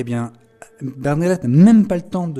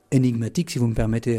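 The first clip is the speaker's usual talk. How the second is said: ordinary talk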